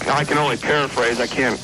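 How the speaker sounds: normal talking voice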